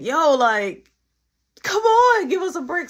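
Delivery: high, loud pleading to the cops; desperate plea. Retold or imitated. Pleading loudly with the cop not to take her brother. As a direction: beg them to give a break.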